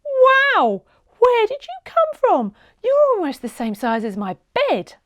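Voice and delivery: put-on high, loud, amazed child; little girl amazed by a giant turtle; amazed excitement. A narrator's reading. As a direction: gasp in wonder at the huge turtle.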